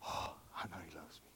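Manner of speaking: soft unvoiced whisper. Believer realizing God's love, whispered. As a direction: breathe it like a secret joy.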